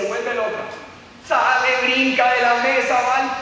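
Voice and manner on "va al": ordinary talk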